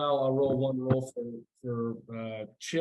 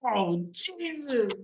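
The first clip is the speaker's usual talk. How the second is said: high, drawn-out mock yell; acted yell; 11 semitones higher, much slower than usual. Game master voicing a character who yells out, high mock yell. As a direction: yell it out high and slow, without much volume. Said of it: "He's gonna yell out again".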